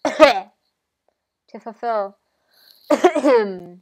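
slow, loud, cough-broken speech; coughing through words. Acted. Spirit pushing through the prophecy, coughing loudly. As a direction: force the words out between coughs.

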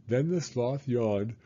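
plain reading voice

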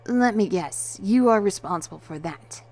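loud, mildly accusing; forceful, slightly cross delivery; slight scowl. A narrator's reading. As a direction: accuse her with a bit of a scowl. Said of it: "I scowled a bit towards her and said".

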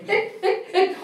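high, squeaky little hiccup sounds; mock hiccups. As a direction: hiccup like a pestering demon. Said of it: "demons just running around going".

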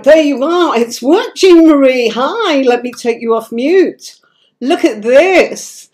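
high, loud, thrilled welcome; over the moon that the guest link worked; excited. Real, not acted. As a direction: burst out with delight as the guest appears. Guest finally appears on screen.

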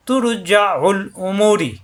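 তুর with a heavy r, slowly chanted; tajweed recitation. Shown as a demonstration. Teacher recites the example to show the heavy ra. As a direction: chant তুর with a thick, full r. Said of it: "মোটা হবে".

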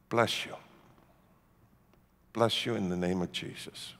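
soft, low, measured blessing; gentle blessing. Retold or imitated. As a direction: bless them quietly, with long pauses. Gentle blessing to his accusers.

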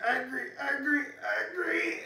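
mock angry. Shown as a demonstration. Teacher showing kids an angry body, hammed-up mock anger. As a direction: play a furious kid for fun. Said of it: "Make it as angry as you can".

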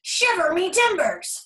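high, loud, slow pirate cry; hammed-up shiver me timbers; pirate exclamation. A narrator's reading. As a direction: exclaim like a surprised old pirate. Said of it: "the pirate said".

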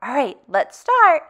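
normal talking voice

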